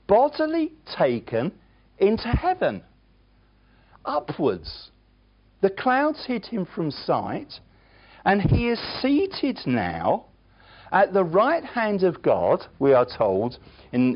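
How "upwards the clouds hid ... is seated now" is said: normal talking voice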